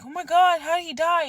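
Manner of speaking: high, loud distressed cry; upset outburst. Retold or imitated. Vlogger asking how the cat died, high and loud. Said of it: "and I'm like".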